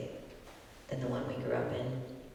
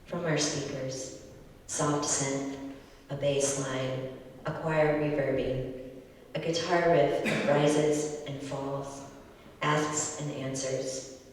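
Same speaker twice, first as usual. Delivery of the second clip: louder, earnest spoken-word delivery; open mic poet; 5 dB louder than usual. Acted. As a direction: perform it like an open mic set. Poet performs poem after intro.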